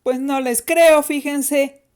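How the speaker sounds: defiant girl's put-on voice. A narrator's reading. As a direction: throw it back as a dare. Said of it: "les respondió de manera retadora".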